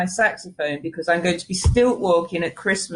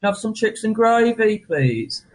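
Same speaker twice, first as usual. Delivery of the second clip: higher-pitched Brummie impression; Brummie accent; 4 semitones higher than usual. Shown as a demonstration. Showing the host a bit of Brummie, playful Brummie accent. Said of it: "I can do a bit of Brummie".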